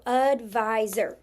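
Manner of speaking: ADVISOR slowly broken up as ud-vi-zer; chopped into syllables. Shown as a demonstration. Teacher chopping out a word to spell, slow syllables. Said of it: "Chop it out".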